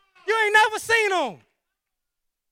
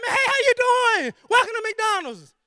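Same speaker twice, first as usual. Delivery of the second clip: bright, higher, louder greeting from a thrilled fry cook; excited McDonald's worker; 5 semitones higher, 3 dB louder than usual. Retold or imitated. Friend John at McDonald's greets you, eager and excited. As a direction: greet the customer like you love this job. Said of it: "He all excited".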